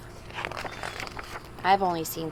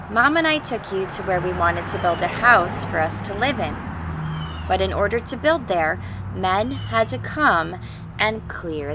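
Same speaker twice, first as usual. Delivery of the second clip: put-on clear, louder reading-to-children voice; storybook read-aloud; 3 semitones higher, 6 dB louder than usual. Teacher reading a picture book to the class, storybook voice. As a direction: read it like a father's letter to his kids.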